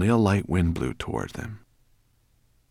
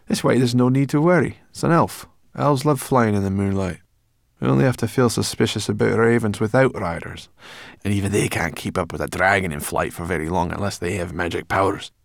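a cheeky, know-it-all forest creature; brownie voice; 2 semitones higher, 4 dB louder than usual. A narrator's reading. Sorrel voiced by narrator.

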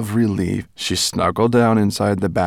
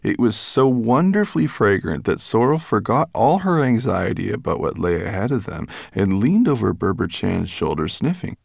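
ordinary delivery, nothing marked

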